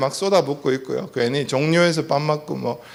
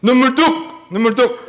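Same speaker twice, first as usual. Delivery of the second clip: put-on hushing a crying child sternly; mock stern command; 6 semitones higher than usual. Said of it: "우리는 뭐라고 얘기하죠?". (우는 아이에게 단호하게 "눈물 뚝!")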